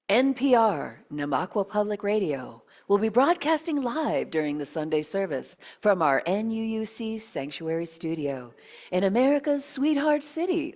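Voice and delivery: loud, booming radio promo voice; radio announcer. Acted. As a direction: sell it like a public radio host. Radio station promo read.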